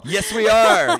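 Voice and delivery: loud, high cheer; excited shout. Real, not acted. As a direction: shout it out, hyped up. Hyped at the Cotton Bowl.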